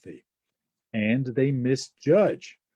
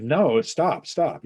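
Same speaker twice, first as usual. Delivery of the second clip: high, louder protest; 6 semitones higher, 6 dB louder, slower than usual. Retold or imitated. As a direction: push the helper away, raised. Gary refusing the blanket.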